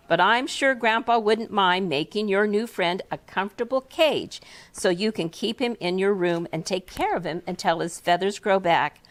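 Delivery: plain reading voice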